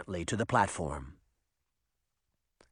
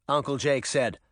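usual speaking voice

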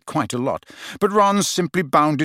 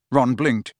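usual speaking voice

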